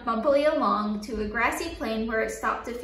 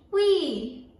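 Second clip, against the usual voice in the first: gleeful; 3 semitones higher, 3 dB louder than usual. A narrator's reading. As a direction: swing out with a happy whoop. Swinging on vine.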